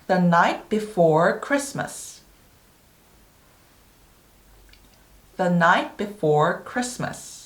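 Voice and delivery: very slow, word by word; measured model for repeat-after-me practice; slow repetition. Shown as a demonstration. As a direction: repeat the title slowly for learners, leaving pauses. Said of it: "한 번 더".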